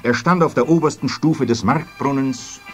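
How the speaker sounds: ordinary delivery, nothing marked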